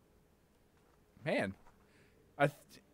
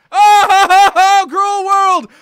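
hammed-up wail to the heavens, higher and louder; mock tragedy; 9 semitones higher, 13 dB louder, slower than usual. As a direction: cry out at fate like a stage tragedian. Dramatic wail at death in game.

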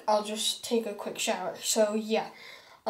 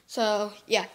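usual speaking voice

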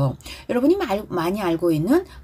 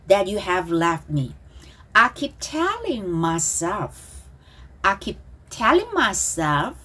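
slow, measured phrases for repeating; slow pronunciation model; much slower than usual. Shown as a demonstration. Teacher leading students in repetition, slow and deliberate. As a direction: stretch the English words, phrase by phrase. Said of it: "저를 보고 발음 따라하기".

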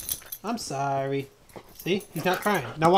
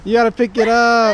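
loud teasing; 8 semitones higher, 9 dB louder than usual. Real, not acted. Teasing partner about poop.

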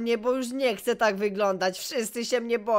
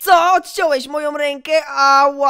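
shocked shout; 3 semitones higher, 8 dB louder than usual. Acted. Patient yelling at the doctor who cut off his arm, shocked shout. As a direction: yell it like you just found your arm gone.

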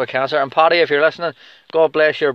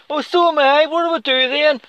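a much higher, squeaky mock voice; mocking high squeak; 13 semitones higher than usual. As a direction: squeak it out like a scared fighter. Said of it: "you're like".

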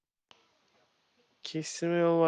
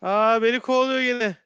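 high, loud alarmed cry; being chased; 9 semitones higher, 5 dB louder than usual. Real, not acted. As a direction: panic as it chases you. Monster chasing him in game.

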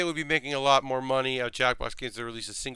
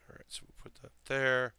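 whispering; 12 dB quieter than usual. Real, not acted. Muttering to self while building.

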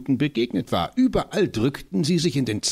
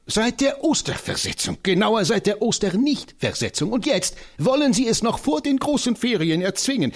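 high, fiery voice of the tiny professor; rally for battle with comic zeal; an excitable old scholar, pitched high; eccentric little professor; 9 semitones higher than usual. Acted. Professor rails against pupils.

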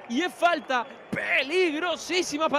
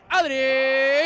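sudden call as he shoots; shouting a name; 8 dB louder than usual. Real, not acted. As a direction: yell the name out loud. Calling the shot as it's struck.

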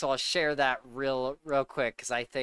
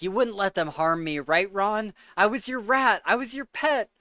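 rising, higher whiny plea; pleading Peter; 6 semitones higher than usual. Retold or imitated. Peter begging Ron for protection, lightly whiny. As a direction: beg like a cornered rat. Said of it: "he goes over to Ron and he's like".

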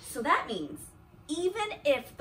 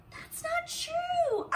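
mock protest; slower than usual. Mock protest against the book.

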